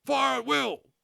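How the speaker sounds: high, loud barked order; a sergeant major giving the order to fire; sergeant major command. A narrator's reading. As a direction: shout it like a drill sergeant. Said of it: "sergeant major voice rang shrill and clear".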